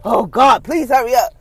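acted exasperated prayer; impatient plea. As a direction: plead as if you can't wait.

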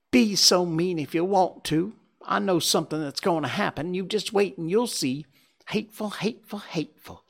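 high, stamping, petulant scolding; bossy little princess. Acted. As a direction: spit out hateful like a spoiled girl. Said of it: "she stamped her little foot and said".

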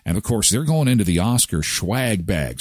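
usual speaking voice

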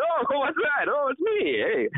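high mock-innocent surprise; feigned surprise. Acting surprised at finding their own CD playing, feigned surprise. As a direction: put on a high, surprised act.

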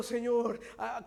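ordinary talk, nothing marked

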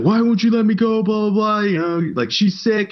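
high, whiny pleading; whining sailor. Retold or imitated. Sailor begs the duty leader to go home, high whiny pleading. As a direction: beg the leader to let you go home. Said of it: "the guy's like".

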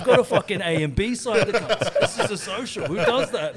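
hammed-up outrage over the other team; mock outraged rant. Retold complaint from other teams.

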